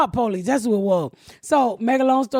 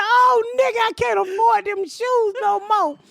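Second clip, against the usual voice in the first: high, shouted lament over the shoes; hammed-up despair; mock wailing yell; 9 semitones higher than usual. Crying out to her son about losing expensive shoes, wailing.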